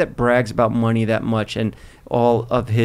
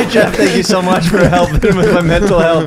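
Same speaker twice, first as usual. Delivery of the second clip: higher, louder, laughing through a mock fan message; laughing mock recap; 6 semitones higher, 5 dB louder than usual. Co-host recapping a fan's awkward message for laughs, laughing mock recap. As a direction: parody the fan's message, cracking up.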